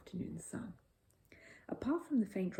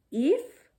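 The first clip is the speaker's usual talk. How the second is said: loud, high alarmed "If?"; a sudden startled cry; 9 semitones higher, 11 dB louder than usual. A narrator's reading. Family alarmed at Mr Brown's word, loud surprise. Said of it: "a chorus of alarm".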